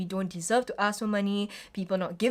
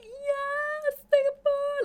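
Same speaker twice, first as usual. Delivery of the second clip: squealed high, hammed-up "Yes, Singapore!"; mock patriotic cheer; 3 dB louder, much slower than usual. Mocking a National Day fan, squealed high patriotic cheer. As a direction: cheer like a flag-waving fan at the parade. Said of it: "wear all red and just be like".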